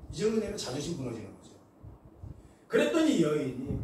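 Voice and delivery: ordinary delivery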